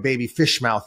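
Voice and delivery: usual speaking voice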